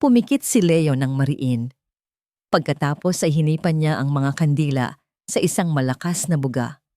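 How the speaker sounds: usual speaking voice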